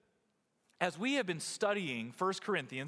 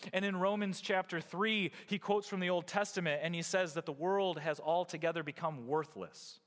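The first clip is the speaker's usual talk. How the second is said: ordinary talk, nothing marked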